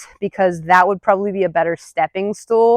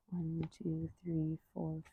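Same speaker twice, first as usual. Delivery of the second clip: much softer, barely voiced counting; under-breath count; 3 semitones lower, 25 dB quieter, slower than usual. Real, not acted. Trainer counting duration under her breath. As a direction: count quietly to yourself.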